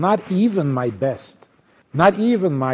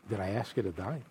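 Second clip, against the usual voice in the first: ordinary talk, nothing marked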